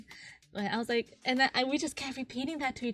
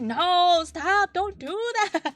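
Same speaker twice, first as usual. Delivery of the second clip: playful protest; 9 semitones higher, 10 dB louder, much slower than usual. Retold or imitated. Retold friend protesting.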